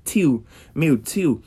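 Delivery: "tio, meu tio." slow, with a puff of air after each "t"; Pernambuco aspirated t. Shown as a demonstration. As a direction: slowly breathe out the "t" in "tio". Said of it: "tem um arzinho".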